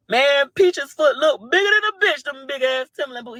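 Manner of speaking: high-pitched teasing jeer; mocking taunt. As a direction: call it out high, playing to the crowd. Acts out the repeated taunt.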